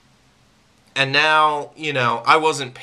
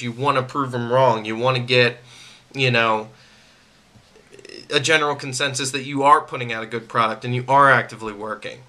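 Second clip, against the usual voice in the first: ordinary talk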